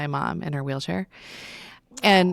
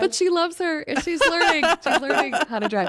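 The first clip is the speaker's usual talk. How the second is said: much higher, laughing through the words; laughing defense; 11 semitones higher, 3 dB louder than usual. Retold or imitated. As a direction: giggle while defending the little driver. Mother defends daughter, laughing.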